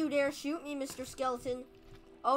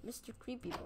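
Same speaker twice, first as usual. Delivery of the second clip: normal talking voice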